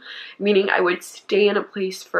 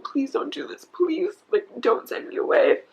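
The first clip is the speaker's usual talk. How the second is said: retold begging; 6 semitones higher than usual. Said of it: "I remember begging my mom".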